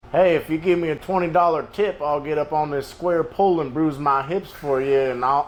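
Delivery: put-on high, loud cheeky old man; old grandpa voice. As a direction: ham up a randy grandpa's offer. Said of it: "your grandpa's telling you".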